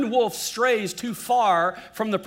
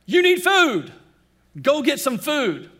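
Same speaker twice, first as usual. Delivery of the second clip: higher, louder insistent Hunger voice; 6 semitones higher, 5 dB louder than usual. Acted. Preacher voicing Hunger demanding food, insistent. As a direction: play Hunger nagging you to eat. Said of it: "Hunger says".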